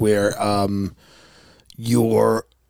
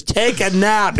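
mock mom order; 8 semitones higher, slower than usual. Mother ordering nap again.

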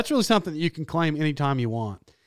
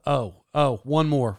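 ordinary talk

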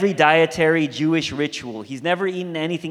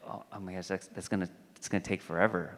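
dismayed aside; 7 semitones lower, 13 dB quieter, much faster than usual. Retold or imitated. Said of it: "I was like".

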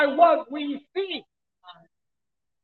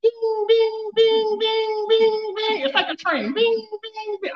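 bouncing bing sounds; 8 semitones higher, slower than usual. Retold or imitated. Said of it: "up and down like a yo-yo".

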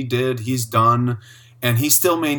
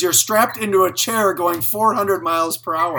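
higher-pitched incredulous rant; imitated comedian; 6 semitones higher, 4 dB louder than usual. Recalling the comedian's airplane skit, imitated incredulous rant. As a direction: lightly mimic a comic's exasperation. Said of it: "'cause he says".

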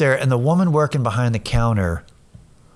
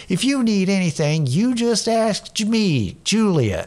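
higher, lilting, kindly helper voice; sweet children's host; 5 semitones higher than usual. Retold or imitated. Counter woman offering help to a lost shopper, sweetly childlike. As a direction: reassure as if explaining to a child. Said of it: "this is how she talked".

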